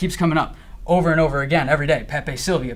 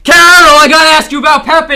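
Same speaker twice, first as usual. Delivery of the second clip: frantic loud yelling; shouting a name; 10 semitones higher, 14 dB louder, slower than usual. Retold or imitated. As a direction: bang on the door and yell. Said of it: "and I say".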